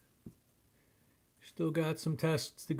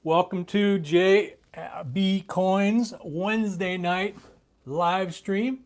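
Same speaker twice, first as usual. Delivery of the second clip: big stream intro; 5 semitones higher, 9 dB louder, slower than usual. Real, not acted. Streamer opening the live show.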